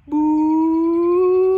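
high, loud sustained "boo" hoot; long hoot sliding gradually upward; imitated ball flight. Elephant tries again to throw, imitated ball flight.

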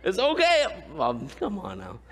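ordinary delivery

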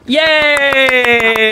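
high, long-held "yay"; drawn-out celebratory squeal; mock cheer. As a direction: cheer with one stretched note. Cheering after introduction.

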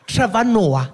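loud, high "Trevor Noah"; caricatured clerk reading out a name; suspicious receptionist. Acted. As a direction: pronounce the name as dawning recognition.

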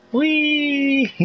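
high, gleeful whoop of delight; gleeful whee. Real, not acted. Streamer whooping at a flying biker, gleeful high whee.

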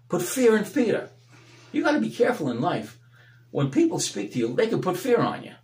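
fervent preacher, rising and falling; preaching. Acted. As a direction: preach it out to the congregation. Preaching at full force.

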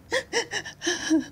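high little sobbing breaths; acted sobs. A narrator's reading. Girl sobbing to the birds, acted high sobs. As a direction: sob in short high catches. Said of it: "sobbed Anne".

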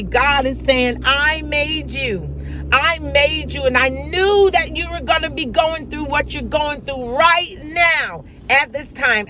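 rising, fervent preacher's call; impassioned preaching. Acted. Preacher voicing God to the congregation, fervent preaching. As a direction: preach it out with full conviction.

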